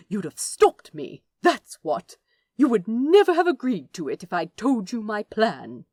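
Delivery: heated retort. Acted. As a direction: throw it back at him. Angel shouting in a quarrel.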